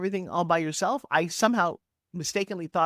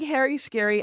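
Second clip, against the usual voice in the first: ordinary delivery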